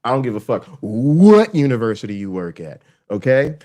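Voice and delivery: normal talking voice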